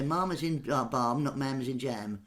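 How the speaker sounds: Ma'am said as "mahm", long open a like balm; not "mam" like jam, but "Ma'am" as in balm. Shown as a demonstration. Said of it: "And they say".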